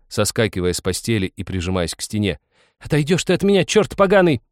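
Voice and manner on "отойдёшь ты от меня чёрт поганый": high, loud frightened outburst; panicked angry woman; rough, hiding terror. A narrator's reading. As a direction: curse him to mask your fear. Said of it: "старалась она за грубостью скрыть свой ужас".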